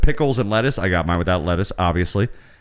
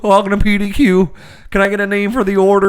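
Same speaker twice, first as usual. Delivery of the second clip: put-on a teen doing Shaggy from Scooby-Doo; 10 semitones higher, 4 dB louder than usual. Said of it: "That's the best Shaggy from Scooby-Doo".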